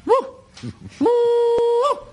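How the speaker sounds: high falsetto hooting woo calls; loud high-pitched call into the night; bunyip mating call. Retold or imitated. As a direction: hoot it like an animal calling in the bush. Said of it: "So I did it again".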